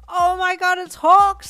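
loud, high-pitched shriek; fan scream. A narrator's reading. Fan spots the famous hero in the street, screaming. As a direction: scream it in thrilled recognition. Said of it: "someone screamed".

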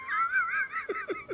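high shrieking laugh; squealing laugh; burst of laughter. Real, not acted. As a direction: crack up at the punchline. Teller laughs at own joke.

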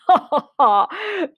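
big mock monster guffaw; hammed-up belly laugh; bursting with laughter. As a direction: laugh out loud as the Gruffalo. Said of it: "bursting with laughter".

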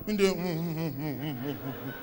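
low, soft humming mumble; muffled mm-mm, trailing off; closed-mouth mumbling. Acted. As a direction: mumble with lips shut, fading away. Robbed man with swallowed money.

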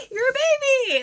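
high, loud, gleeful tease; teasing triumph. Retold or imitated. Idol teasing younger fan about her age, triumphant. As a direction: crow it high and loud, point proven.

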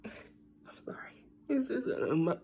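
whispered through tears, barely there; fighting back tears, almost no voice; tearful whisper. Real, not acted. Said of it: "Why am I crying so much?".

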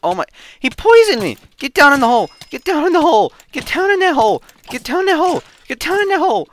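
loud high frantic yell; high loud desperate panic; panicked shouting. Real, not acted. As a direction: shout at yourself to get down. Poisoned, fleeing in panic.

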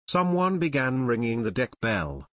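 usual speaking voice

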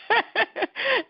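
high, bright burst of laughter; a quick laugh at the idea; amused laugh. Real, not acted. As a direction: laugh at her own refusal. Laughing at refusing the talk.

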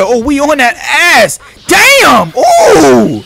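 loud high cheering, first words drawn out slowly; excited shouting at the game; hype shout. Real, not acted. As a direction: yell like you just landed the combo. Landing hits on the boss.

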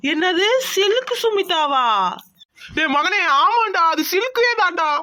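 high, drawn-out astonishment; stunned disbelief. Acted. Family seeing film star's photo instead, stunned disbelief. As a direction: gape at it and stretch the words.

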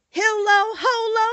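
loud, high-pitched spell chanting; magic incantation. A narrator's reading. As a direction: call out the charm word by word. Dorothy recites the magic charm.

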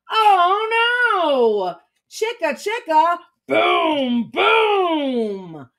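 high-pitched oh no into long, falling booms; dramatic alarm. A narrator's reading. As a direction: draw out each boom like a crash. Story climax, tree crashes down.

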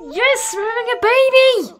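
high, loud gasping cheers; overjoyed. Real, not acted. Thrilled player cheers at the pregnancy news, high and loud. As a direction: scream with joy at the news.

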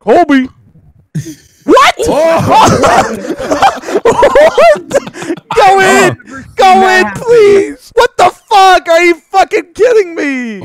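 loud, high disbelieving shouts; frustrated yelling. Real, not acted. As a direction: yell it at the missed shot. Yelling at missed shots.

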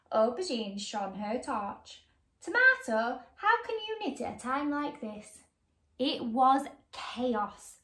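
put-on lively read-aloud to children; bright, animated storybook reading; story narration. A narrator's reading. Reading a picture book to young viewers, lively.

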